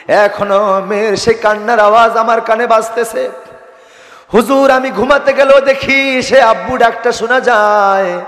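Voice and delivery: high, ringing lament of a haunted father; anguished preaching. Acted. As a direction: lift the father's confession into a sermon chant. (ওয়াজের সুরে বাবার উঁচু গলার বিলাপ)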